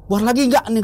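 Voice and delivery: high, loud question to a friend; urgent retold question. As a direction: call it out, pitched right up. Acted phone call urging friend out. (bertanya lantang dengan nada tinggi)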